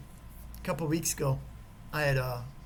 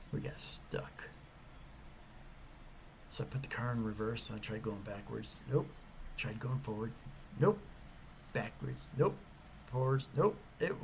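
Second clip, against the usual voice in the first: ordinary talk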